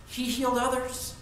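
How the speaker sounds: high, loud taunting jeer at Jesus; mocking crowd. Scoffing crowd taunts Jesus on the cross, mocking high. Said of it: "They'll actually say the same thing about him".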